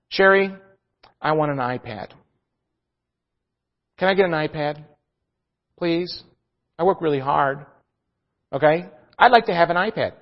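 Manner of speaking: soft, pleading husband asking for an iPad; mock begging. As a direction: plead softly and humbly, half in jest. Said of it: "Begging and pleading".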